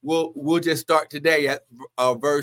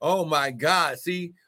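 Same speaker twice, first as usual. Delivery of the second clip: ordinary delivery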